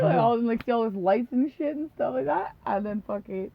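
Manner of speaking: ordinary talk, nothing marked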